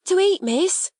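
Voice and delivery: high, thrilled interruption; great excitement. Acted. Servant girl Becky interrupts Sarah, very excited. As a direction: break in amazed at the promise of food. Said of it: "she broke in with such excitement".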